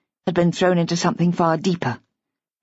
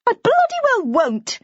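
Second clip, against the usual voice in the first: a high, slow, tearful refusal; tearful defiance; slower than usual. A narrator's reading. Tearful Bridget defying the man, high and slow. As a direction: near tears, refusing flatly. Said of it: "The tears were returning".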